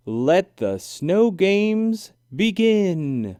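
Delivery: slow, drawn-out proclamation; grand announcement. A narrator's reading. Judge opening the games to all, slow grand call. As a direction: announce it slowly to open the games. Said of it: "Now I will end by saying".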